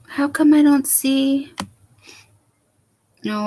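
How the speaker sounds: ordinary talk, nothing marked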